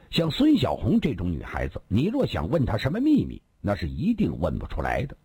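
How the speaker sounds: usual speaking voice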